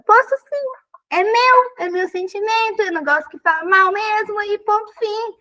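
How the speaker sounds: high, firm and forceful insistence; emphatic insistence. Real, not acted. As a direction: stand your ground with conviction. Defends her right to anger. (insistência firme e aguda)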